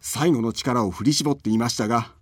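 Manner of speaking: usual speaking voice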